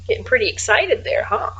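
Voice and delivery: usual speaking voice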